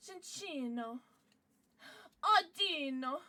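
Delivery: badly faked Italian accent; attempted Italian accent. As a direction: draw the names out in a playful Italian accent. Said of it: "do that in an Italian accent".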